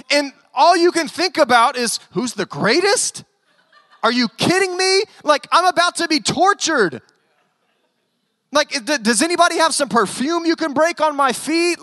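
high-pitched, exasperated rant; Jesus, frustrated. Acted. Imagined Jesus scolding self-absorbed disciples, acted-out frustration. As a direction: vent like a teacher nobody has listened to. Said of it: "just getting so frustrated and going".

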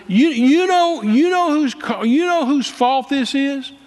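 high, slow, stammering challenge; drawn-out indignant question; heated accusation. Retold or imitated. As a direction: re-enact confronting them, too worked up to finish. Retold accusation of the preachers.

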